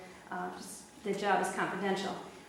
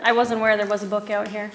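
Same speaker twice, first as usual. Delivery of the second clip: repeated louder for someone who missed it; 8 dB louder than usual. Real, not acted. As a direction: say it again, louder and clearer. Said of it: "I'm sorry?".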